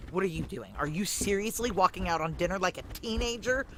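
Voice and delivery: building from a sharp scold to a loud, high yell; rising fury at herself, ending in a shout; screaming at herself. Retold or imitated. Stomping to the basement, yelling at herself in rising anger. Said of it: "screaming at myself".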